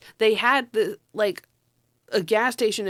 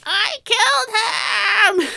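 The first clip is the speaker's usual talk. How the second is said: slow, high, crying shout; squealing, sobbing yell, much higher and louder; imitated scream; 14 semitones higher, 6 dB louder, much slower than usual. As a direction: wail it out in horror at what he's done. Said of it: "John Candy screamed".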